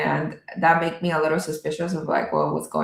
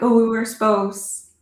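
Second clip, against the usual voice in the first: slow, higher, mock-horrified gasp of a line; mock panic; 5 semitones higher, 3 dB louder than usual. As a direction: play at being alarmed, drawn out. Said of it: "I can only imagine my reaction".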